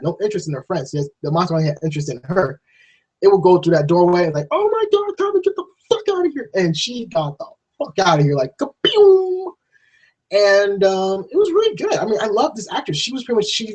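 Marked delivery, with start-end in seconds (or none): 4.5-6.5 very high, shrieking hurry; mock panic. Voicing heroine escaping. 8.6-9.5 drawn-out kaboom. Pretend. Sound effect of fast escape.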